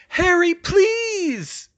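high, drawn-out loud plea; slow, very high pleading cry; desperate tearful call. A narrator's reading. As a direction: call out in vain, begging. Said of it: "she called in vain, tears streaming down her face".

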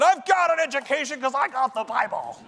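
mock his own smug younger self; cocky young self. Young seminarian snaps back at church pastors, smug and loud. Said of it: "I said".

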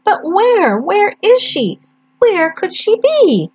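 put-on high small voice of a lost baby bird. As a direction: ask it as a tiny hopeful chick. Baby bird wonders aloud.

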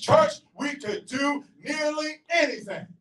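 ordinary talk, nothing marked

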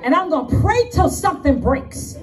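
full-force preacher, punchy repeated phrases; fervent preaching. Real, not acted. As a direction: preach it like a battle cry.

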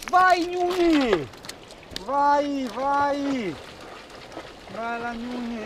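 high, slow, urging call; egging the dog on; talking to a dog. Real, not acted. (incitando il cane, voce acuta)

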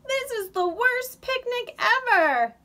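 high, loud lament; wailing complaint. A narrator's reading. Squirrel wailing to Mole about the picnic, loud lament. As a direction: cry out that everything's ruined. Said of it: "wailed Squirrel".

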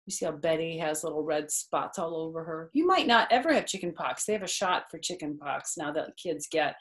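ordinary delivery

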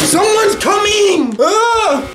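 very high, slow alarmed cry; panicked. Real, not acted. Gamer hears the master coming, panicking. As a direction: warn in fright, then scream.

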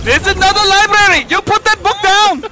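high squeaky mock voice. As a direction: pitch it way up, teasing. Continues mock shop voice.

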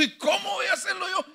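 preacher plays Gideon crying out how can I; anxious Gideon cry. Retold or imitated. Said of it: "Gedeón dijo".

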